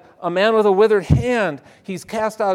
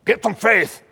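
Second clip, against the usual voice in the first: scolding rebuke; 2 semitones higher, 4 dB louder, faster than usual. Retold or imitated. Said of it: "we hear Jesus going".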